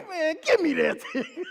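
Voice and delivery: high, loud comic snap; loud, fed-up punchline; mock indignation. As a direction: demand it with hammed-up exasperation.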